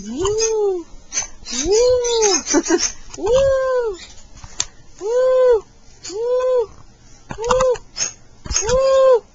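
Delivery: high-pitched woo; long, high playful "woo"; playful whoop. Pretend.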